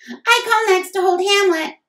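put-on squeaky high child voice, eager; eager little sister. A narrator's reading. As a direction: shout it like a kid claiming a turn. Youngest sister calling dibs.

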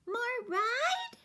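put-on very high little-girl plea; pleading toddler. A narrator's reading. Toddler pleading with the roach for another ride. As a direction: beg sweetly like a toddler. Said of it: "pleaded Boots".

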